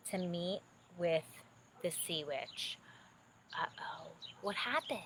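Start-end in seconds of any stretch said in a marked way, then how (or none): 3.6-5.0 whisper. Real, not acted. Reader whispering suspense to the young listener. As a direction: lean in and whisper, uh-oh. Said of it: "reader whispers to child listener".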